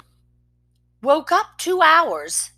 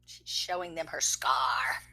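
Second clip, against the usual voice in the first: whispered aside; 11 semitones lower, 14 dB quieter than usual. Real, not acted. Parent pausing the story to whisper to the child. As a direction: murmur the comment under your breath.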